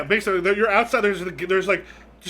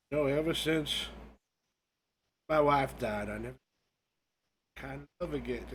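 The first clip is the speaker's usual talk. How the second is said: low, quiet, halting widower; lightly voiced grieving ghost, low and soft; mournful ghost; 3 semitones lower, 6 dB quieter than usual. Ghost tells of his wife's death, low and halting.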